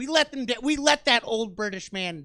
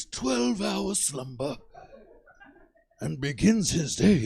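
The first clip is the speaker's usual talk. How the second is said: grave nature documentary narration of a stoner; David Attenborough narrating; 2 semitones lower than usual. Retold or imitated. Comic imagining Attenborough narrating his life as a stoner. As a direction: narrate my day like a wildlife film. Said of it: "but with David Attenborough, imagine".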